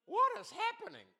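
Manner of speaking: shrill high and loud disbelief; young man stunned mid-service; bewildered. Retold or imitated. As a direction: squeal it out completely thrown. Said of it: "he's like".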